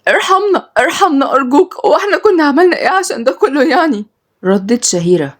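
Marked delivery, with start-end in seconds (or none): 0.1-4.1 high, tearful begging; crying plea. A narrator's reading. Captive girl begging for mercy in tears. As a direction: beg for mercy through tears. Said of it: "ردت ملك بعياط".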